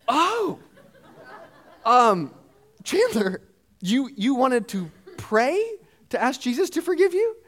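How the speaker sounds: put-on the penny drops, softening toward the child; surprised realization. Dad realizing his toddler wanted to pray, high surprised softening.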